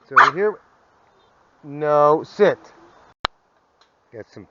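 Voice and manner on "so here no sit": dog command. Real, not acted.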